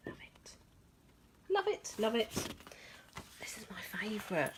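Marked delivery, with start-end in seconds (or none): 0.1-0.5 barely audible whispered delight. Real, not acted. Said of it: "I'm whispering". 3.4-4.5 soft confiding whisper. Real, not acted. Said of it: "I'm whispering".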